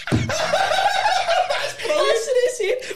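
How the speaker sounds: high, loud, through real laughter; laughing. Real, not acted. Friend cracking up at the old car ad, laughing loudly. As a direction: crack up mid-sentence.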